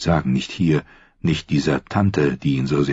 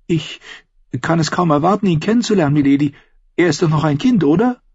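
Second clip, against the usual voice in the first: put-on higher, halting young voice; timid girl; 7 semitones higher than usual. A narrator's reading. Sansa blushing as she answers her aunt, hesitant. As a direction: stammer it out, embarrassed.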